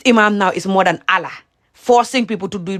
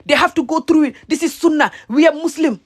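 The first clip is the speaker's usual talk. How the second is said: mock angry preacher, pitched higher and raised; imitating an angry imam; 7 semitones higher than usual. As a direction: mimic the imam ranting about tradition. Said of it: "you will see how this imam will be so angry".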